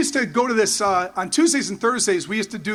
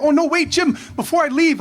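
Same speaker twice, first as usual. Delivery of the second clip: hurried call back; 6 semitones higher than usual. Retold or imitated. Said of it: "and I go".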